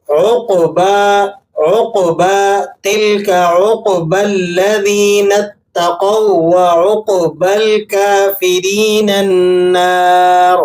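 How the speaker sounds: عقبہ with heavy ق and lengthened با; correct recitation. Shown as a demonstration. Teacher models the right reading, slow chant. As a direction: draw out عقبہ slowly. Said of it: "درست ادائیگی کے ساتھ پڑھا جائے گا".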